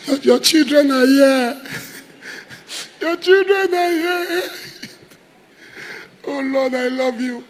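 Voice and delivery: high, breaking voice between laughs and sobs; overcome, half laughing, half weeping before God; laughing-crying worship. Real, not acted. Preacher breaks into emotional worship.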